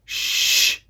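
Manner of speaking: breathy shh; hushing. Acted. Princess hushing Meg, breathy. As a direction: hush her gently.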